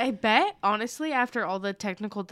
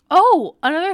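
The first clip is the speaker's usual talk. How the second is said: shrieked, much louder and higher; sudden scream; 8 semitones higher, 13 dB louder than usual. Real, not acted. Suddenly remembering news for the listeners, loud shriek. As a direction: blurt it out at full volume as it hits you. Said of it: "I just screamed really loud".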